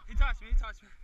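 loud protesting call; shouting. Real, not acted. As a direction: yell out the tag. Calling a tag in play.

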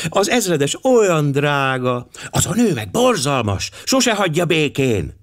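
put-on high, gushing girlish voice; girls exclaiming. A narrator's reading. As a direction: exclaim it like two excited girls. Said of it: "kiabálták mindketten". (magas, lelkendező lánykiabálás)

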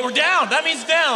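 high-pitched comic eagerness; hammed-up sudden understanding; eager realisation. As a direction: announce it as if just cracked the code. Acts out figuring out the garble.

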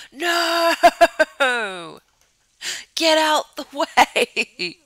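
long, high, loud wail through laughter; dismayed shout. Real, not acted. Gamer yelling at character blocking the shower, dismayed shout. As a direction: yell in comic panic, stretching the words.

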